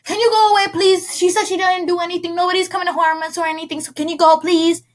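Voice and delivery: put-on high childlike pleading voice; child begging cops to leave; little girl voice. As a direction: plead with the police like a small child.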